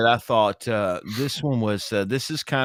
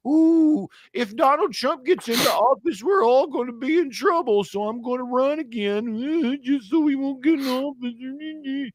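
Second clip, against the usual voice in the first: mocking Biden. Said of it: "Joe Biden's just like".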